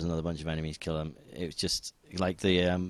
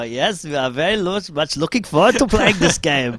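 a hammed-up German accent, higher and louder; mock German accent; 9 semitones higher, 8 dB louder than usual. Mock German accent to a German developer in the queue. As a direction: ham up a German accent as a joke. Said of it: "so he's putting on a German accent".

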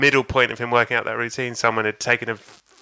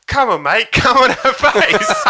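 loud, high, drawn-out egging on; heckling bloke; 10 semitones higher, 5 dB louder, slower than usual. Retold or imitated. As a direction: build to a slow shout from the crowd. Bloke in crowd joins heckling.

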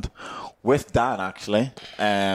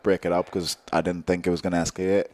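ordinary delivery

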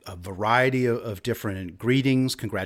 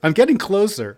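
higher, louder, gleeful repeat; excited; 10 semitones higher, 8 dB louder than usual. Acted. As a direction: push it up with playful glee. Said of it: "I need to try another one".

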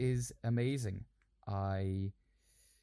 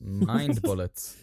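mock dramatic; 3 semitones lower, 6 dB louder, much slower than usual. Slow mock-dramatic phrase.

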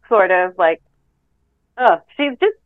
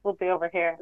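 meek aside; 3 semitones lower, 10 dB quieter than usual. Retold or imitated. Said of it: "was kind of like".

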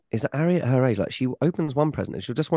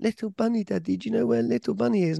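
put-on high toddler voice; 11 semitones higher than usual. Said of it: "she went".

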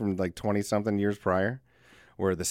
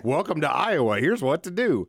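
cheesy TV host; 6 semitones higher, 5 dB louder than usual. Pretend. Channel guide host voice.

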